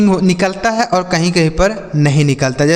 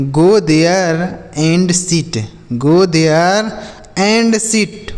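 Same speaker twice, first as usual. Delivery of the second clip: very slow dictation; much slower than usual. Shown as a demonstration. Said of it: "teacher models sentence".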